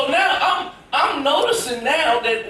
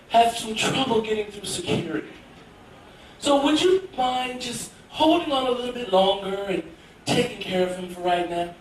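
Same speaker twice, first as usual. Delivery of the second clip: polite flight attendant; 4 dB quieter than usual. Retold or imitated. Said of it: "the flight attendant comes back".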